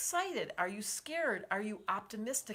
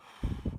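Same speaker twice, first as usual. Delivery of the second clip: deep exhale. Shown as a demonstration. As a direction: breathe out fully for the group. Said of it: "deep breath".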